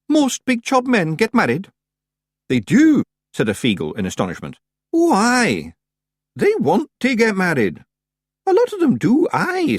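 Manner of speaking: very high, loud Scots wee voices; high astonished Scots pictsies. Acted. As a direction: marvel at it like tiny Scots rogues. Feagles marvel at marriage.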